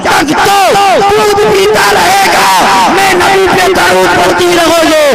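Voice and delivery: very high, shouted, slowing mother's voice; mother's devout cry. Acted. Mother praying over her nursing son, shouted devotion. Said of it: "माँ कहती है".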